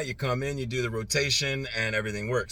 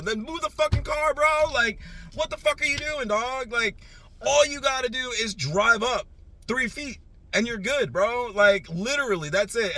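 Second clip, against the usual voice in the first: much higher and louder, exasperated yelling; retold furious yelling; 14 semitones higher, 7 dB louder than usual. Driver shouting at man blocking the lot entrance, exasperated yelling. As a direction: bark instructions at him, fed up. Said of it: "and of course I'm yelling".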